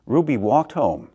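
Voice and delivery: ordinary delivery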